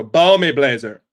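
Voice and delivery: loud, over-enunciated "Ball me, Blazer"; catchphrase callout. Shown as a demonstration. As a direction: bellow it, every syllable crisp. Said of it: "you have to say it like that".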